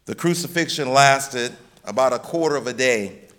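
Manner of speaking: ordinary delivery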